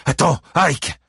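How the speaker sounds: high, loud plea to stop; urgent call. Acted. Buddy Lee calls after Ike at the door, urgent. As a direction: call him back before he leaves.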